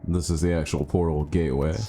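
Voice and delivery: ordinary talk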